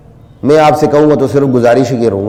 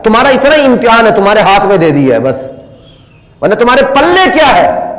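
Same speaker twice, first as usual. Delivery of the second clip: high, raised challenging questions; fervent rebuke; 6 semitones higher than usual. Real, not acted. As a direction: press the listeners hard. Preacher challenges listeners. (اونچی آواز میں سامعین کو للکارنا)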